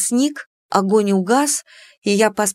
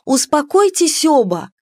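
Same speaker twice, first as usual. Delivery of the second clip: high, raised voice to calm them; slightly raised voice; 7 semitones higher, 3 dB louder than usual. Acted. Heroine calms two quarreling suitors, raised voice. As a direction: raise your voice a little to stop them. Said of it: "слегка повысив голос".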